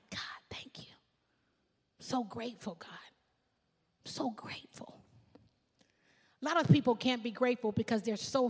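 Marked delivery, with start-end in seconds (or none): 0.1-5.0 hushed whisper of thanks to God; whispered prayer. Pretend. Solomon's grateful prayer, retold.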